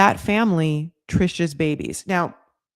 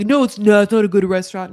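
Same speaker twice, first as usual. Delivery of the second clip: louder, insistent retort in an acted quarrel; mock contradiction; 6 dB louder, faster than usual. [name] arguing with Trish about a restaurant, mock insistent. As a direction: cut in and firmly disagree.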